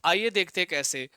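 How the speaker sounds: ordinary talk, nothing marked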